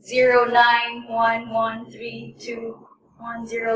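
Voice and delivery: numbers read slowly and evenly; slow, clear dictation of digits. Shown as a demonstration. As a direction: dictate each digit carefully. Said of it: "For example".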